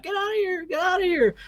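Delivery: shrill panicked cry of get out; retold screaming. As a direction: mimic a terrified family screaming to flee. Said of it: "were all screaming".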